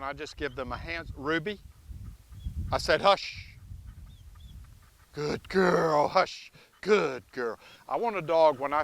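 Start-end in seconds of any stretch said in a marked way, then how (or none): 1.2-3.6 firm correction. Real, not acted. Correcting barking dog. 5.1-7.6 calm her down gently; soothing praise. Real, not acted.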